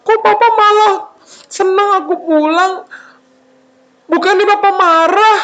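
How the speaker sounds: high-pitched, bewildered questioning; confused. Acted. Son puzzled that papa welcomes him, bewildered wail. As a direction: ask in wailing puzzlement. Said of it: "Terus dia bingung".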